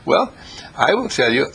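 ordinary delivery

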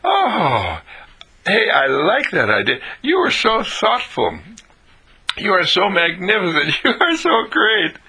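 high, gushing admiration; delighted incredulity. Acted. Thanking the ice cream bringer, delighted incredulity. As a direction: praise them in amazed delight. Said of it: "that's incredulity".

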